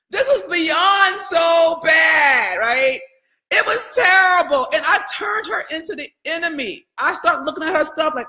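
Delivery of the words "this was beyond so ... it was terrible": outraged shout. Real, not acted. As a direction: drag out how bad it was. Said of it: "I was outraged".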